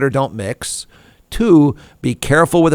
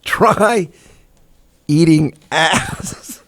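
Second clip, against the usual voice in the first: laughing so hard the words stretch; quote through laughter; 2 semitones higher, much slower than usual. Retold or imitated. Host reads the tweet, laughing slowly. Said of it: "Not drinking this January".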